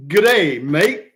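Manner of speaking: a hint of an Australian accent, loud and cheery; a light Aussie G'day to a fan; mock Australian greeting. Greeting Australian viewers in a mock Australian accent. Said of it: "Tasmania, Australia".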